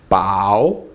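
"bao" low, then rising; low fall-rise on "bao"; dipping third tone. Shown as a demonstration. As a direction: let "bao" sink and swing back up. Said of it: "Third tone".

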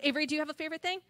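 usual speaking voice

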